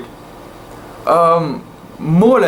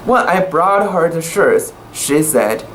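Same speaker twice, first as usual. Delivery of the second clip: usual speaking voice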